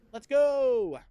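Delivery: high, loud hype shout; excited cheer. Real, not acted. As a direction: pump it up, let's go. Cheering on moving to next.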